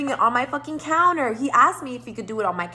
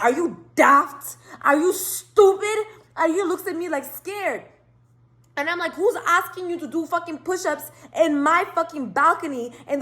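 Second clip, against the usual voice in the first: angry yelling; 6 semitones higher than usual. Retold or imitated. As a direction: tear into him for the push-ups. Yelling at guest on balcony.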